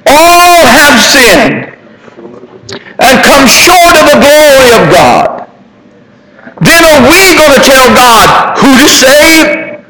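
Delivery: full-force preaching. Acted. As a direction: preach it out to the congregation, building.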